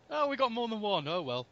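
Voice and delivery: mock resigned, starting high and sinking; acted world-weary acceptance, falling away; cynical resignation. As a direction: shrug it off, pitch sliding down to indifference. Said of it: "He's so cynical".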